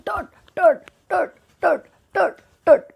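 high tut sounds; tut tut steps. A narrator's reading. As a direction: tap out the turtle steps. Turtle moving sound.

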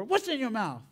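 high-pitched imitation of a suspicious aunt; stern aunt. Aunt questions grandson about his mouth, stern. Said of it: "he knows he's in trouble".

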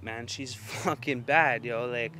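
normal talking voice

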